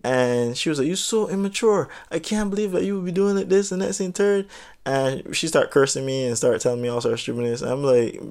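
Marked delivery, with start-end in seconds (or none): 0.9-4.5 higher, whiny scolding voice; mocking the girl. Imitating the girl scolding the storyteller, higher and whiny. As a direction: nag like her, pitched up.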